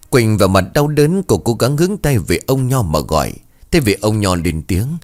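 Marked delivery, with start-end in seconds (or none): none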